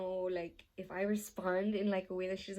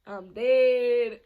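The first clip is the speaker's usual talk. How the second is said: loud, drawn-out exclamation; hammed-up loud panic; mock doom; 2 semitones higher, 13 dB louder than usual. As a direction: belt it out as if doomed. Acted reaction to death threats.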